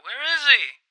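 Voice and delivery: high, loud shout into the crowd; yelling out. Retold or imitated. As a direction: call out over the crowd. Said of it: "somebody in the crowd yelled out".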